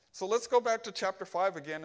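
ordinary delivery

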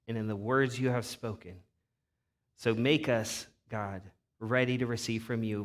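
a quiet, reverent prayer to the Father. Acted. Pastor praying over the congregation, hushed and reverent.

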